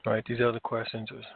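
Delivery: ordinary talk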